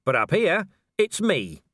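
loud, high defiant shout. Acted. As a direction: yell it in triumph. Defiant fight with werewolf.

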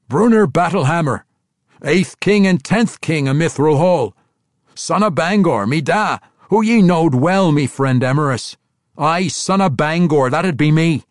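loud, high, proud proclamation; booming dwarf boast; proud young dwarf. A narrator's reading. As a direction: declare your lineage to the whole hall. Bruenor declares who he is.

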